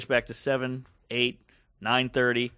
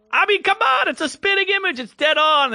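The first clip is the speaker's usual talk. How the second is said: very high, loud mock German accent; excited German man; 6 dB louder than usual. German man insisting on the resemblance, loud German-accented protest. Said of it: "the German guy was like".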